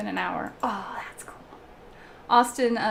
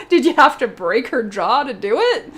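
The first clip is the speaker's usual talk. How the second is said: appalled disbelief; 2 semitones higher, 7 dB louder than usual. Retold or imitated. Retells appalled reaction to a scene.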